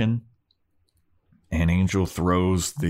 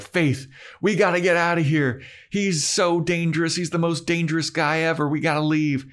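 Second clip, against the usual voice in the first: higher, pressing mock alarm; urgent Buffy; 10 semitones higher than usual. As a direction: hurry your friend out of danger. Buffy urging escape.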